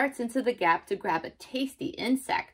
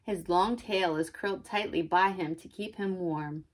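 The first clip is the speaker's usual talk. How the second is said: plain reading voice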